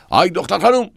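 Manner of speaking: high, loud shout across the platform; a loud high call to a nurse. Acted. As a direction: yell to the nurse to clear the cups. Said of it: "داد زد".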